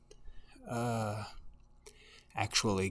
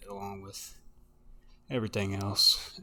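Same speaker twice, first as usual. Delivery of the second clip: ordinary talk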